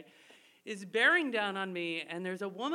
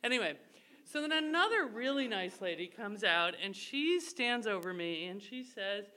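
ordinary delivery

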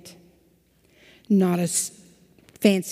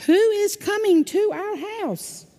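a much higher, hammed-up cow; big old cow voice; 12 semitones higher than usual. A narrator's reading. Pastor voicing the big old cow for the children, higher. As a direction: ask it like a fussy big old cow, way up high. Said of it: "But the big old cow says".

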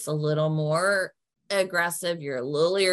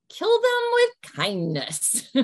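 sugary, sing-song and slow, pitched way up; sweet; 4 dB louder, slower than usual. Shown as a demonstration. Advising on staff, a sugary sing-song. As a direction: lay the sweetness on thick. Advice on being nice to staff.

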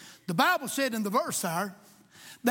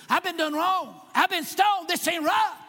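hammed-up aggrieved protest, higher and louder; mock complaint; 5 semitones higher, 3 dB louder than usual. Preacher mocking himself as a litigious victim, hammed-up aggrieved shout. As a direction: play the wronged victim demanding his rights.

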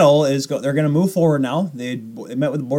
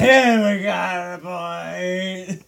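mock fan cheer; 3 semitones higher, much slower than usual. Imitating fencing fan cheering.

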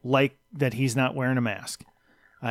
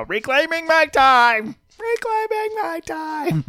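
high, slow, drawn-out mock congresswoman; sing-song imitation of a politician holding the floor; Rashida Tlaib voice; 5 dB louder, slower than usual. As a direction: stretch each word out, much higher and slower. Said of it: "in a Rashida Tlaib voice".

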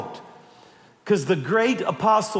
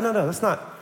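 usual speaking voice

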